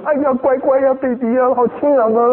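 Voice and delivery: a hint of sing-song baby talk; doting parent. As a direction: coo happily at the baby. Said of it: "你还很高兴的去说".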